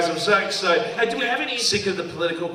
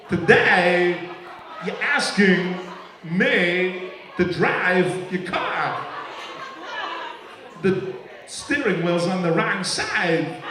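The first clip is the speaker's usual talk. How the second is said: halting delivery with long odd pauses; Christopher Walken; much slower than usual. Acted. Comic as film star asked to drive, halting impression. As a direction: break it up oddly, punching stray words. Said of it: "I love Christopher Walken's voice".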